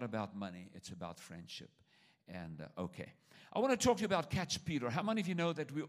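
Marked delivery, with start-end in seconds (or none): 3.5-5.8 fast talk. Real, not acted. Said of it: "talk real fast".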